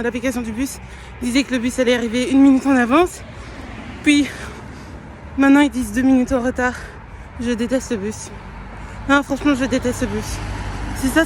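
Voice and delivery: loud, exasperated complaining; angry rant. Real, not acted. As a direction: vent about the bus, loudly. Ranting about a late bus.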